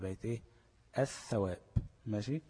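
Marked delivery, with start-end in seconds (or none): 1.0-1.6 الثواب as ath-thawab, doubled ث; lam merged into tha. Shown as a demonstration. Teacher giving the merged result, الثواب with held ث. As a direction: linger on the ث, leaving out the L. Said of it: "وادغمت الثاء في الثاء".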